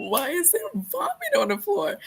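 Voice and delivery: high-pitched mock scream of disbelief; high, dramatized shriek; retold scream. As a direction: squeal it as if horrified, retelling. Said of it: "screamed out".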